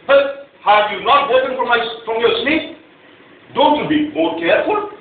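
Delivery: loud, high, scolding yell; angry yell. Retold or imitated. Husband yelling over coffee spilled on his shirt, loud and high. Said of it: "I yell at my wife".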